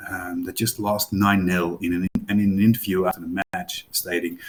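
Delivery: normal talking voice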